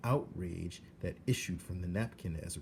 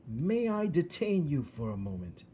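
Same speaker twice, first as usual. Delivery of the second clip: polished, courtly rat voice, a bit higher; suave rat; 7 semitones higher, 4 dB louder than usual. Acted. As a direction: address her with silky rat manners. Rat Roscoe speaks to Meg.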